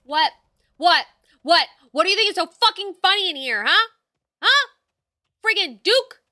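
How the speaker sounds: loud, high hammed-up rage; mock angry rant. Streamer playing an angry boss at laughing characters. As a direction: tear into them in fake fury.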